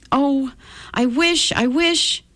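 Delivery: high, pleading wish; crying out a wish. A narrator's reading. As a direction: wish urgently, pitch climbing high. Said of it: "cried Rosie".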